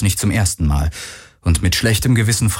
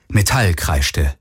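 ordinary delivery

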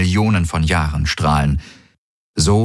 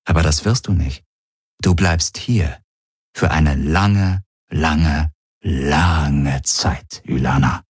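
lange, lange, lange stretched slow and sinking lower; drawn-out menace; 3 semitones lower, slower than usual. Acted. Villain relishing her endless sentence, slow and sinking. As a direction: savour each lange slowly, dropping lower.

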